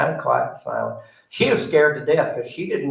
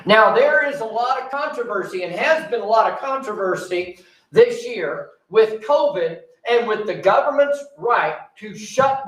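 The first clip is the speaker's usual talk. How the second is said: higher, building sermon voice, slow in places; preaching; 7 semitones higher than usual. Acted. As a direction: press the point to the congregation. Preaching on church shutdowns.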